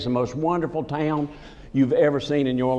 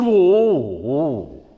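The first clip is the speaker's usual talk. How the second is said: funny-talking barber; 4 dB louder than usual. Retold or imitated. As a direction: imitate his odd swooping exclamation. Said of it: "But he talked funny".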